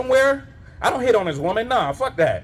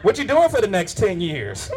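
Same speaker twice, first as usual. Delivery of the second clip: mock flirting; 3 semitones lower than usual.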